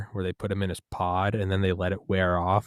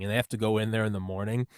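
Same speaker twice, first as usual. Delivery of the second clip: ordinary talk, nothing marked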